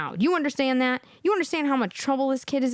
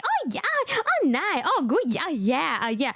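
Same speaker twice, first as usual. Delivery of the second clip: mocked non-English speaker. Imitating women who answer everything with pleasantries, swooping mock chirp. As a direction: gush empty pleasantries, pitch bouncing up and down. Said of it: "This is how they talk".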